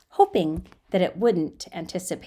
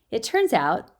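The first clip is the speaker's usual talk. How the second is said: plain reading voice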